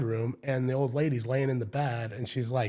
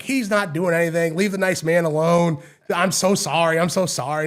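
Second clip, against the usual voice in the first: loud, raised mock-mom voice; loud, higher, exasperated retelling; flustered mom scolding; 4 semitones higher, 7 dB louder than usual. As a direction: imitate a mother calling off her son and apologizing. Retold mom scolds son, apologizes.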